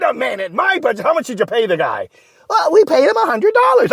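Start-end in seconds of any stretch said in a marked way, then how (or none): 2.5-3.9 squeaky sidekick. Acted. Ray admits small payment.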